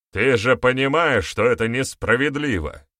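barked protest. A narrator's reading. Elder protests to Boryata about the challenge, barking. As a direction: snap back in protest. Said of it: "рявкнул один из старейшин".

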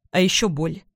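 ordinary delivery, nothing marked

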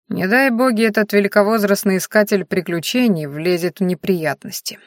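usual speaking voice

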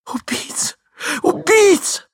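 от сдавленного вскрика к визгу; вскрикни, затем взвизгни очень высоко; крик дамы. A narrator's reading. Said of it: "кричала дама". (истеричный женский крик)

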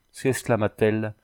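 ordinary delivery

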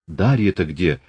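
ordinary delivery, nothing marked

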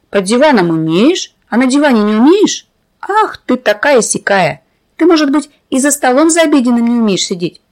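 ordinary delivery, nothing marked

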